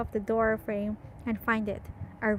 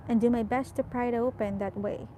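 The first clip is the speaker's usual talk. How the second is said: usual speaking voice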